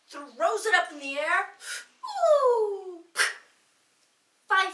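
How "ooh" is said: mock impressed ooh. Crowd reaction to the throw.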